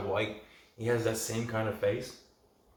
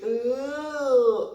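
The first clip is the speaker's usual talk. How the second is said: long, very high squealed "ooh"; loud falsetto "ooh", held; imitated "ooh" of shock; 13 semitones higher, 6 dB louder than usual. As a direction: mimic her drawn-out, high-pitched reaction. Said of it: "she goes".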